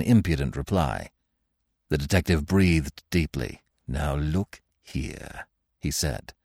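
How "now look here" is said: soft, very slow confidential tone; hushed, measured; lowered voice. A narrator's reading. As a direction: drop the volume and slow right down. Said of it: "lowering his voice".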